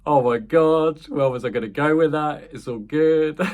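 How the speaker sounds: amused at yourself, voice lifted and breaking into laughter; laughing. Real, not acted. Speaker losing the thread after nearly doing an accent, laughing. Cracks up at own abandoned accent.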